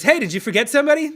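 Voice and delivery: high, loud, fast call-out; guy in wheelchair. Retold or imitated. Wheelchair user confronts the comedians after the show, loud and high. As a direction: pitch up and call him out quickly. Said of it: "And he goes".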